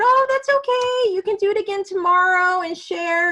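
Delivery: reassuring teacher. Retold or imitated. Teacher comforting a boy whose bean plants died, high reassuring voice. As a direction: lift the voice way up to soothe a child.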